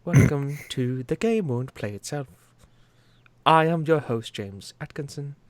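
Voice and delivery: a hint of an RP accent, pompous show host. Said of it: "do R P just so I can be like".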